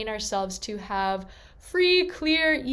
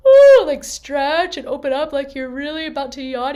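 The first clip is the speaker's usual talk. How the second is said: high yawning ooh, words stretched through a yawn; 5 semitones higher, 13 dB louder than usual. Shown as a demonstration. Coach yawning to show students the lifted palate, yawning. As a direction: talk through an open yawn. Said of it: "do it like you're yawning".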